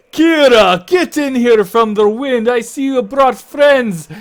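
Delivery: booming, high-pitched shouted welcome; big hearty man. Acted. Big man welcoming Kira out of the wind, booming. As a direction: bellow a hearty greeting to an old friend. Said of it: "big old smiling face with a beard".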